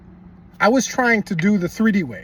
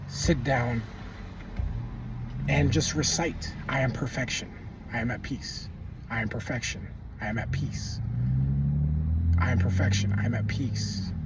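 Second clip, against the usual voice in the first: calm affirmation recital; 5 semitones lower, 6 dB quieter than usual. Acted. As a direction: recite it like a calming mantra, hushed.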